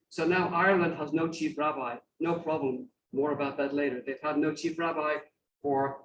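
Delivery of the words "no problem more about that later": normal talking voice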